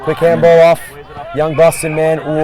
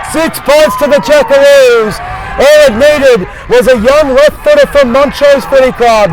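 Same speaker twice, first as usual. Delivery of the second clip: much higher and louder, shouted call; excited goal shout; 6 semitones higher, 4 dB louder than usual. Real, not acted. Commentator calling the late Columbus goal, shouting with excitement. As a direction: roar the goal home, stretching it out.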